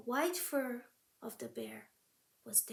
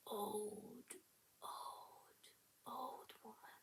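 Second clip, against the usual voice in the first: put-on each old quieter, near silent; fading repetition; 3 semitones lower, 15 dB quieter, much slower than usual. A narrator's reading. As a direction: let each old fade.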